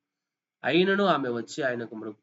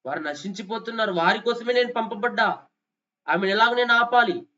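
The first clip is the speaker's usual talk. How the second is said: higher, louder impassioned declaration; full-force preaching; 6 semitones higher, 5 dB louder than usual. Acted. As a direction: proclaim it like a fiery preacher. Preacher voicing Jesus forcefully.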